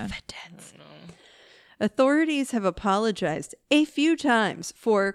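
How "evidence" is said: whisper. Real, not acted. Disbelief at missed evidence.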